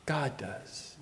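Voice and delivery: breathy hushed whisper, barely voiced; preacher whispering the answer to his question. Real, not acted. Answering own rhetorical question.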